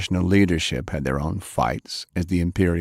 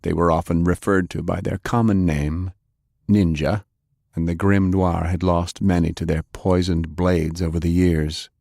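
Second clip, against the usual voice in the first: plain reading voice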